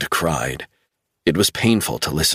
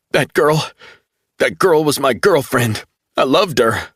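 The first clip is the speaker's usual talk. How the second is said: higher, strained and breaking with grief; grieving young man; 7 semitones higher, 3 dB louder than usual. A narrator's reading. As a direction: force out the words through shock. Travell reveals girlfriend died.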